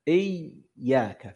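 إياك with a slow, strong doubled ya; stressed shadda. Shown as a demonstration. Teacher slowly demonstrating the shadda of إياك. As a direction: lean hard on the doubled ya in إياك. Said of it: "لاحظتم هذا".

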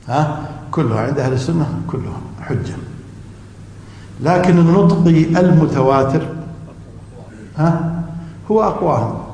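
usual speaking voice

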